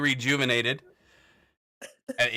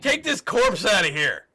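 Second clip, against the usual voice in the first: raised, higher acted yell; yelling back; 8 semitones higher, 5 dB louder, slower than usual. Game master voicing a guard ordering the bugbear, yelling. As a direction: call out a slow, loud order. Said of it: "and he yells back".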